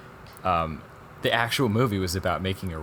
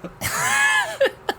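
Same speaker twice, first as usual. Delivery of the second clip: high shrieking laugh bursts out; loud burst of laughter; 5 dB louder than usual. Real, not acted. Friend recounting a silly documentary scene, loud shrieking laugh. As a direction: cackle out loud at the absurdity.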